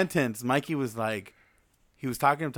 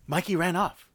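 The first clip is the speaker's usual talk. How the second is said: raised alarmed voice of a friend; imitating Sammy; 5 semitones higher than usual. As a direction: call out that he ran off, worried. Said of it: "he's like".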